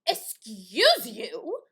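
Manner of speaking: drawn-out, loud affronted question; sassy indignation. Retold or imitated. Sister told she's not allowed downstairs, sassy indignation. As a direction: stretch it out, mock-offended. Retold indignant reply to brother.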